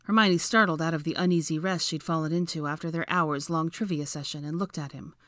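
ordinary delivery

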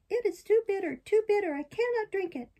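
high-pitched puppet protest; balky puppet. Acted. Puppet refusing the fairy's bitter drink, petulant.

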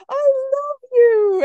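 very high, slow and doting; lovestruck dog voice. Retold or imitated. Teacher voicing her dog professing love to the deer, very high and slow. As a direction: coo it like a besotted puppy. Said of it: "he's like".

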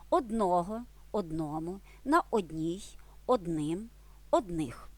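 Одного, одному, одним, одних with the stress on the ending. Shown as a demonstration. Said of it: "Зверніть увагу, наголос ... на закінчення". (наголос на закінченні: одного, одному, одним)

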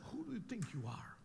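hissed rebuke in a whisper; scolding whisper. Acted. Jesus scolding the wind in a whisper. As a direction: scold it under your breath. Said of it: "He scolded".

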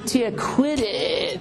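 put-on high, slow whiny child; bickering kid. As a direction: whine at a sibling. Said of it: "They're like".

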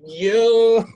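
a high, loud indignant "Yo"; hammed-up betrayed shout; mock outraged yell. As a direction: bark it out in fake shock. Girl admits she faked her picture.

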